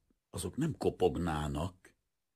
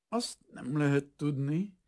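slow, halting; 10 semitones higher than usual. Acted. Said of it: "felelte lassan akadozva".

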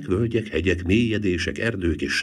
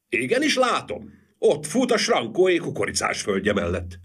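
high, carrying answer from afar; eager report, starting high; calling down; 8 semitones higher than usual. A narrator's reading. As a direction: call down from the tower. Bell-ringer answers down from the tower.

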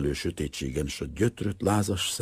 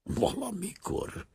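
soft, slow, near-whispered word; quiet, drawn-out reflection; wistful afterthought; 13 semitones higher, 9 dB quieter, slower than usual. A narrator's reading. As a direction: let it out slowly and softly. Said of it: "megpróbált mosolyogni".

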